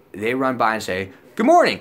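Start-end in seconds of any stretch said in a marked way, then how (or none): 1.4-1.8 high, loud runner's hello; imitating a jarringly chirpy passer-by; sudden loud greeting. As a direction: blurt a greeting in passing, pitched way up. Voicing a runner startling him.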